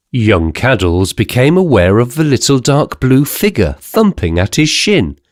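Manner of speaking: ordinary delivery, nothing marked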